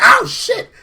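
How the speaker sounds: high, loud startled cry; hammed-up fright; mock startled yelp. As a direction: jump at the sudden voice. [name] startled by voice.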